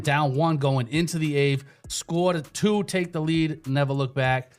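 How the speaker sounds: ordinary talk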